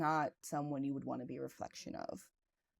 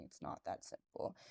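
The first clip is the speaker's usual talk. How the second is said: breathy retold sob; 10 semitones lower, 10 dB quieter than usual. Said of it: "I'm bawling my eyes out".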